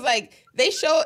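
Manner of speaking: usual speaking voice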